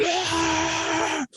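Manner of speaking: high strangled mock scream; a wordless high shout of rage; angry fan yell. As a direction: imitate the red-faced fan yelling. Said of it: "he's screaming at the top of his lungs".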